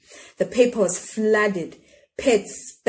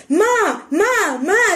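put-on high, loud child's call; girl calling her mother to come see, shouting; 11 semitones higher, 5 dB louder, much slower than usual. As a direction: call out excitedly for Ma. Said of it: "she shouted".

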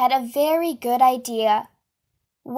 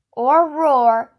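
roaring tiger; 5 dB louder than usual. Acted. Imitating the tiger's roar.

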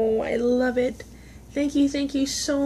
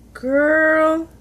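excited; 5 semitones higher, 9 dB louder than usual. Real, not acted. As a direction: call it out thrilled. Excited loud exclamation.

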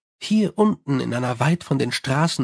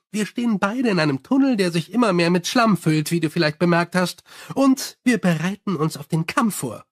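higher, chatty vampire voice; 3 semitones higher than usual. Acted. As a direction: rattle on cheerfully like a gossiping vampire. Sally the vampire speaks.

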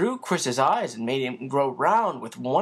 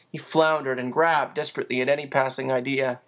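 ordinary delivery

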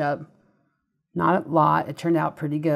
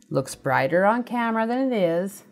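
normal talking voice

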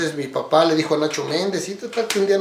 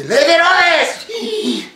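long drawn-out high shout of the band name; slow stretched shout, laughing through it; crowd calling out; 12 semitones higher, 6 dB louder, much slower than usual. Retold or imitated. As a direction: call the name out like a crowd chanting for you. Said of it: "Nos gritan".

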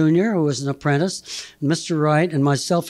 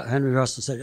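ordinary talk, nothing marked

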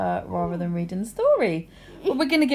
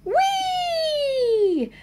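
cartoon princess. Princess squeals at story start.

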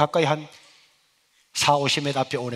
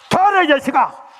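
high, loud furious mother's yell; angry mother yelling; 12 semitones higher, 10 dB louder than usual. Retold or imitated. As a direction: scream at your son for skipping. Mother scolds son at door. (화난 어머니의 고함)

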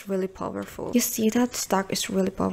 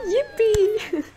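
squealing yippee; 13 semitones higher, 4 dB louder than usual. Real, not acted. Joyful reaction.

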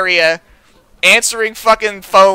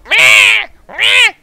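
mocking whine; 12 semitones higher, 4 dB louder than usual.

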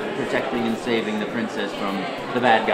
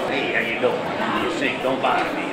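Brooklyn plumber. Retold or imitated. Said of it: "you're an Italian plumber from Brooklyn".

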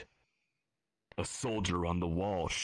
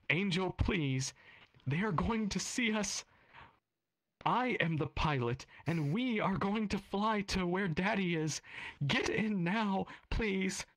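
pleading mother; 13 semitones higher than usual. Acted.

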